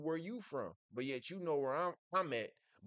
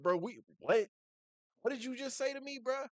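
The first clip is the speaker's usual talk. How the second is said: offended retort; 10 semitones higher than usual. Retold or imitated. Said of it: "And he just was like".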